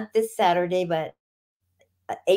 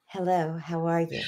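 softer, polite Californian hello; proper Californian greeting; 5 semitones lower, 8 dB quieter than usual. Retold or imitated. Professor teaching a New Yorker the Californian hello, softly and politely. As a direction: say it neat and proper, the West Coast way. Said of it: "Californians say".

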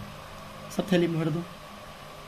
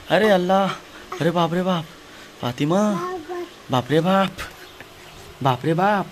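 higher, louder cries of alarm; alarmed exclamation; 5 semitones higher, 3 dB louder than usual. Real, not acted. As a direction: react in startled dismay. Toddler doing something startling. (घबराकर ऊँची आवाज़ में चौंकना)

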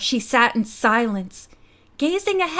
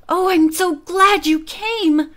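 higher, drawn-out delighted greeting; raised pitch, stretched out gladly; excited cry; 5 semitones higher, slower than usual. A narrator's reading. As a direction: gush with slow, lifted excitement. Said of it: "she cried excitedly".

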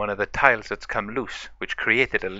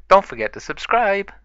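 high, loud character call-out; cheery story character plugging the channel; perky sign-off; 10 semitones higher, 6 dB louder than usual. Acted. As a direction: pitch it up and call to the viewers.

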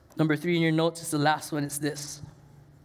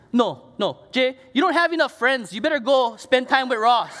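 louder, higher push from the wife; insistent wife; 7 semitones higher, 7 dB louder than usual. Retold or imitated. Wife telling her husband to go see his friend, louder. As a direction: insist he go, half joking. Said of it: "She goes".

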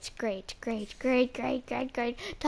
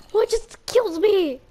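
much louder, higher outcry; shocked yell; 6 semitones higher, 11 dB louder than usual. Real, not acted. After a sudden death, a shocked high yell. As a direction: cry out in disbelief.

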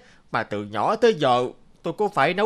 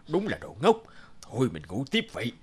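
smug quiet aside; 6 semitones lower, 7 dB quieter than usual. Acted. Smug aside after fooling friend.

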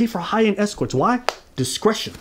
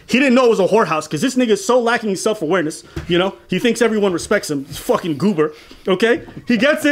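usual speaking voice

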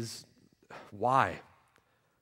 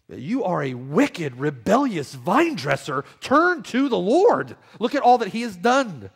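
higher, louder impassioned preaching; full-force preaching; 6 semitones higher, 6 dB louder than usual. Acted. Preacher urging unbelievers to turn to the Lord, impassioned full-voiced preaching. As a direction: plead with the sinner to turn, full voice.

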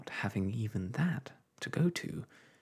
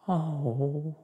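a higher, louder dismayed oh; real dismay; 6 semitones higher, 4 dB louder than usual. A narrator's reading. Wife hearing of the terriers, dismayed oh. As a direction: gasp it out in dismay. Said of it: "in real dismay".

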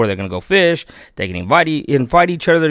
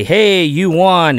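slow, loud, bright "Hey, you won" with a lift; mock scammer; 7 semitones higher, 5 dB louder, much slower than usual. Warning viewers, mimics a scammer in a mock cheery call. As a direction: ham up a fake prize call. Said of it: "these guys that say".

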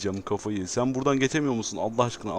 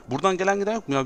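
exasperated; 8 semitones higher, 3 dB louder, much faster than usual. Real, not acted. Nobody uses his road.

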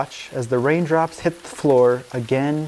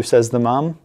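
ordinary delivery, nothing marked

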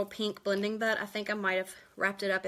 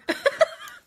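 shrieking burst of laughter; real giggling squeal; high squealing laugh; 5 dB louder than usual. Real, not acted. Laughing at herself.

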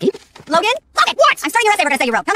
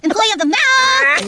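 gloating drawl; 5 semitones higher, much slower than usual. Acted. Boy gloating, drawn out.

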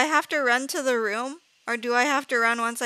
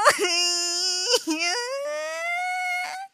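long high sobbing cry; drawn-out tearful whine; frightened wail; 12 semitones higher than usual. Real, not acted. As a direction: wail in real fear. Wailing with fright.

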